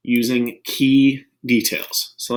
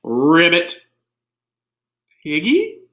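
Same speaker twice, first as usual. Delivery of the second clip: high, loud playful ribbits; cheerful pretend frog croak; Piggy croaking; 6 semitones higher, 5 dB louder than usual.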